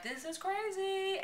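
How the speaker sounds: high, soft, drawn-out amazement; giddy disbelief. Retold or imitated. Passenger marvelling during the van ride, giddy disbelief. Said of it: "I kept being like".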